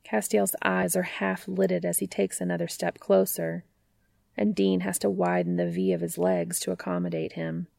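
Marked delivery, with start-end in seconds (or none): none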